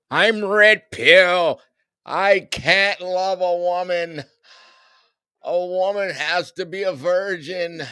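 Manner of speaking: hammed-up imitation of a YouTube dating guru; mock red-pill YouTuber. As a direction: lay down a red-pill guy's rules in mockery. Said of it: "I'm so tired of these dudes on YouTube".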